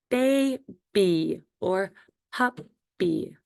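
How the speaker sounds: put-on BABY and PUPPY very slow, a gap between syllables; split into syllables. Shown as a demonstration. Teacher showing first graders two-syllable words, split into syllables. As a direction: draw out each syllable of baby and puppy separately. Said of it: "two or more syllables".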